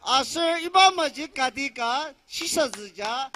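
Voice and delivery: ordinary talk, nothing marked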